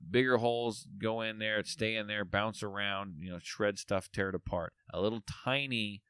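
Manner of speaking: ordinary talk, nothing marked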